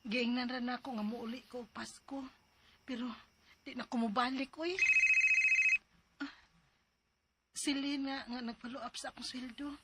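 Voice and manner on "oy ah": ordinary delivery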